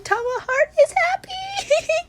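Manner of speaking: very high, loud, drawn-out fangirl squeal; gleeful squeal. Real, not acted. Fan imagining a shy favourite being comforted, gleeful squeal. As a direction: squeal it with overflowing glee.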